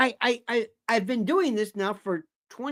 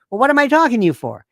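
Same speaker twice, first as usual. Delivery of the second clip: loud, fast, raised-pitch question; exasperated retort; 4 semitones higher, 6 dB louder, faster than usual. Retold or imitated. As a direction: fire it back at someone wasting your time. Acts out exasperated reply to her.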